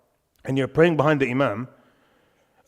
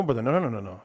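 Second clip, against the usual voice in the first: ordinary delivery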